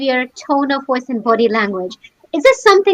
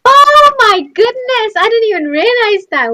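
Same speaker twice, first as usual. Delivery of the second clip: high, loud, stretched-out astonishment; real amazement; 9 semitones higher, 3 dB louder than usual. Real, not acted. Host realises she followed the gesture, truly amazed. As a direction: gasp it out high, astonished at yourself.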